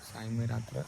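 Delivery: soft goodnight sign-off; quiet at night. Real, not acted. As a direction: say goodnight softly, others asleep. Signing off at night, others asleep.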